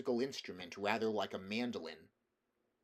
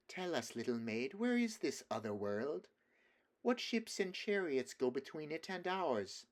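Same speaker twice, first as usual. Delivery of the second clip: teasing witch; 6 semitones higher than usual. A narrator's reading.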